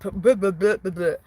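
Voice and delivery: spluttered string of b sounds; tongue-tied babble. Real, not acted. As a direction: blubber your lips after a slip of the tongue. Tripped over the word karaoke.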